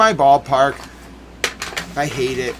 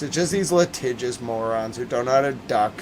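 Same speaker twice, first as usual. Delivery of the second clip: usual speaking voice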